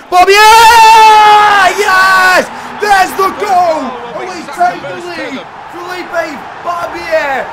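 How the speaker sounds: long, high, stretched yells, slowed right down; goal celebration. Real, not acted. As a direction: roar it out like the winner.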